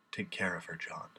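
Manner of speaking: near-whispered, fading plea; hushed dying request. Acted. Dying man entrusting her to his friend, hushed. Acted words of the dying master.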